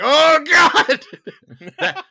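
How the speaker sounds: high, loud shocked outcry; horrified cry. Retold or imitated. Viewer reacting to the possessed babysitter, loud horrified cry. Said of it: "I'm like".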